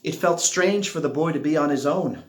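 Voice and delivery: plain reading voice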